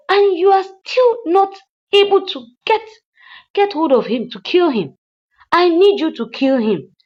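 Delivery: high, loud mock rant; imitating furious orders. Madam Uchechi ordering the hitman to kill Jeff, mock furious. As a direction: bark out orders in a retold rage.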